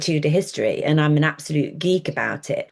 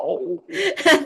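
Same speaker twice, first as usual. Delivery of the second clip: bright high-pitched laugh; 11 semitones higher than usual. Real, not acted. Laughs at the verse.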